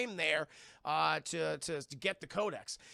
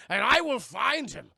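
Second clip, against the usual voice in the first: rising threat; 6 semitones higher, 10 dB louder, slower than usual. Retold or imitated. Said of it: "he's just screaming it".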